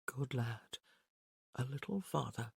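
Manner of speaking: soft, low murmur; willing him closer silently; quiet inner thought. A narrator's reading.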